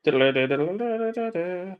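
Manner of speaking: high rapid diddle-diddle trill; music mimic. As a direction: hum it like a chase tune. Mimicking action music while watching.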